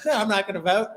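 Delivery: hammed-up Australian accent through laughter; mock Australian accent. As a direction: play the lazy Australian non-voter, laughing. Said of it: "He was Australian".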